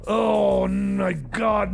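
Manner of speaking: ordinary delivery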